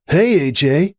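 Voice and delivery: put-on young athlete's casual greeting; Hoops greeting. A narrator's reading. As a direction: call out a cocky hello. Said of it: "he said, looking up with a smile".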